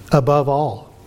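ordinary talk

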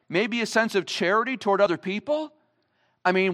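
ordinary talk

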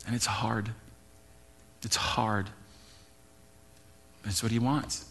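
very soft, low retold confession with heavy pauses; holding back tears. Pastor retelling a tearful woman's hard confession, holding back tears. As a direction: quietly imitate someone struggling to admit it. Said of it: "tears, holding back".

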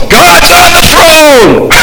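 "God's on the throne!" shouted high and slow, with full conviction; high, drawn-out shout of faith; triumphant proclamation. Acted. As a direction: cry out "God's on the throne!" as a victory call. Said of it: "No, it's".

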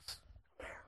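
hushed unvoiced whisper. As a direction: lean in and whisper it. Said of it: "I was like".

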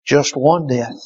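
ordinary delivery

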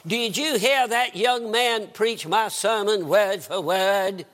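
slow, courtly Southern drawl; charming Southern drawl. Retold or imitated. Preacher asks his wife about a stolen sermon, Southern drawl. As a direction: stretch it out in a genteel Southern drawl. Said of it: "in that charming southern drawl".

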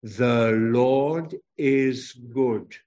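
very slow, every word spaced out; slow group recitation. Shown as a demonstration. Preacher leading children in slow recitation. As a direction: lead the children through it word by word. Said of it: "Say 'em with me now".

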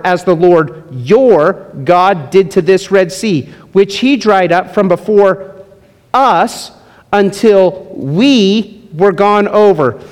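slow, emphatic Bible reading; scripture reading. Acted. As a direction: read the verses with weight, unhurried. Reads scripture passage slowly.

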